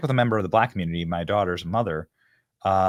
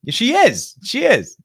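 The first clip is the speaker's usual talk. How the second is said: much higher and louder, insisting; emphatic high call; 9 dB louder, slower than usual. Real, not acted. Host insisting to viewers she is mocking them, loud high call. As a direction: drive it home to the viewers.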